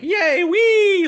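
high, loud giddy squeal; mock giddy squeal. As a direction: squeal it like a giddy kid at a sleepover.

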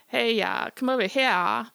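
loud high Southern holler; Southern drawl. Shown as a demonstration. Teller demos the Southern way the dogs are called, Southern holler. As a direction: Foghorn Leghorn-style Southern call. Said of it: "like the Southern, like, Foghorn Leghorn".

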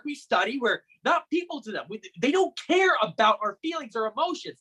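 venting, building on repeated numbers; bitter rant. Acted. Brother rants about students being just numbers, bitter and stammering.

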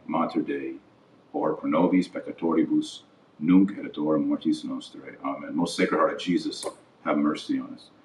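murmured liturgical prayer. Real, not acted. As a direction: pray it quietly and steadily, like leading a rosary. Leads prayers in Latin and English.

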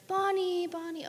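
high calling of a name from upstairs. Retold or imitated. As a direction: imitate the child summoning mom. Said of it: "So then I hear".

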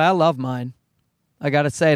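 ordinary talk

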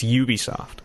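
"Ubisoft" with a y-oo opening; official pronunciation. Shown as a demonstration. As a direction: say "Ubisoft" starting with you. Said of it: "it's officially Ubisoft".

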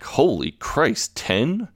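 drawn-out disbelief, leaping up high; retold incredulous reaction, slow then high; mock amazement. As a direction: act astonished, stretching it into a high question. Retold amazed reaction to ten cards.

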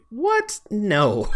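high-pitched retold disbelief; incredulous. Podcaster retelling his reaction to the film, incredulous. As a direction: balk in mock disbelief, voice leaping up.

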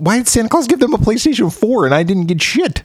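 put-on a kid asking why Santa skipped him, high and whiny; aggrieved kid. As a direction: whine it like a left-out kid. Poor kid complaining, retold.

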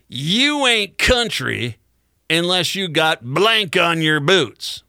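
a hint of a sneering older woman, drawn out slow; retold sales woman. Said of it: "she goes".

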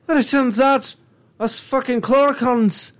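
hammed-up Irish accent as a clurichaun; bad Irish accent. Co-host plays colorblind clurichaun wanting green, bad Irish accent. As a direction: play an exasperated Irishman, badly. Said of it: "the worst Irish accent right now".

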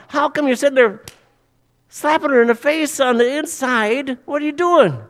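high-pitched, indignant scolding; exasperated protest. Acted. Objector scolding Jesus for slapping her inside, exasperated protest. Acted protest at blunt evangelism.

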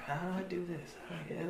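very soft musing to oneself; quiet self-talk. Retold or imitated. Host imagining the actor practising at home alone, very quiet self-talk. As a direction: imitate someone privately puzzling it out, barely voiced.

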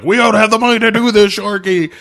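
high, fast, loud-edged complaint; mock protest. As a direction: make a hurried, high-pitched show of objecting. Acting out Frisco's protest to Sharky.

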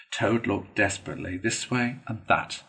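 usual speaking voice

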